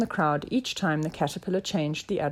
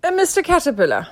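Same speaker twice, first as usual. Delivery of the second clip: quick, high, louder address; detective politely getting attention; inspector; 10 semitones higher, 6 dB louder, faster than usual. A narrator's reading. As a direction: pipe up with an idea.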